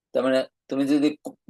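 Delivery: usual speaking voice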